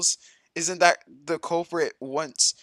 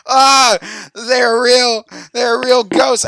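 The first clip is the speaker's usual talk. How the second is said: high, loud delighted cry; loud excited they're real; excited realization; 9 semitones higher, 11 dB louder, much slower than usual. Real, not acted. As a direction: blurt it out thrilled.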